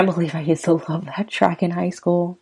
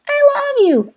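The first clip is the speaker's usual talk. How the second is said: much higher, pleading wife voice, slow; voiced frightened wife; 6 dB louder than usual. Host reading lyrics gives the wife a high, frightened plea. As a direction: pitch way up as the scared wife.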